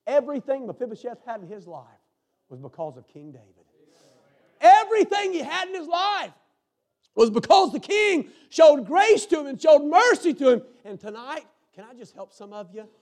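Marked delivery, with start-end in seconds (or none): 0.1-3.6 quiet statement. Shown as a demonstration. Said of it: "you can say it like this". 4.6-10.6 louder restatement. Shown as a demonstration. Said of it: "you can say it like this".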